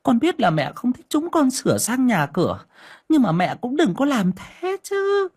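sneering daughter-in-law, pitched high; sarcastic. A narrator's reading. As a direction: needle the mother-in-law with a high-pitched, theatrical complaint. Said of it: "ra giọng mỉa mai".

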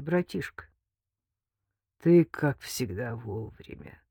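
slow, strained, fading rasp; choked croak. A narrator's reading. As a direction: croak it out through pain. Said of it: "сдавленно прохрипел он".

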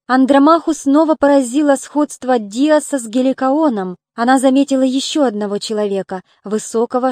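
ordinary delivery, nothing marked

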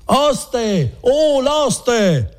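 very high, carrying call. Acted. Renzo seized in bed summons the innkeeper, calling out. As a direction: call for help from another room, pitched high.

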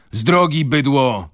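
forceful, high-pitched yell; angry shout. A narrator's reading. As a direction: shout them out of the way. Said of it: "krzyknął Wroński i nie poznaliśmy jego głosu".

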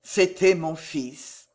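ordinary delivery, nothing marked

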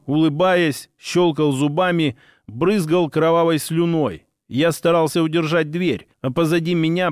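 ordinary delivery

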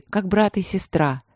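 usual speaking voice

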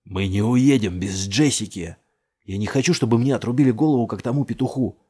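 loud, insistent protest; heated argument. A narrator's reading. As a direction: argue back over the noise. Heated shouted argument at the car.

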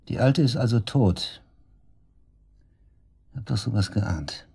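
ordinary talk

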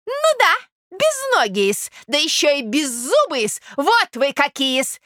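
high, slow, outraged rebuke; affronted lady, scolding. Acted. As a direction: scold a prince for the insult. (оскорблённая дама, высоко и гневно отчитывает)

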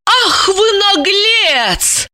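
high, drawn-out outraged cry; furious cry. A narrator's reading. Lipochka flies at Roman with fists, crying out furiously. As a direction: stretch it high and slow in outrage. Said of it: "закричала она".

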